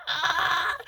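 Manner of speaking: high squealing laugh-cry; delighted squeal. Real, not acted. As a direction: squeal with disbelieving joy.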